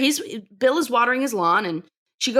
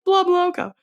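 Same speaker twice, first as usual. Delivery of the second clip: high, playful repeat; amused mock echo; 9 semitones higher than usual. Host repeats Peggy's funny line, playfully amused. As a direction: toss the phrase back in a put-on high voice.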